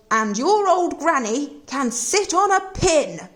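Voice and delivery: slow, drawn-out mocking taunt; a lightly voiced grumpy pig taunting; grumpy pig yelling. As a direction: jeer it at the wolf. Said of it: "the third little pig yelled".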